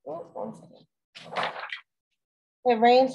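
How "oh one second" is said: quick hushed interruption; whispered aside. Real, not acted. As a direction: break off in a whisper. Interruption off mic.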